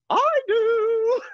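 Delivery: put-on very high, tiny squeaky old-lady "I do"; a sudden shrill chirp from the back of a dark theater; Joanne Worley voice. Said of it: "She really talked like that".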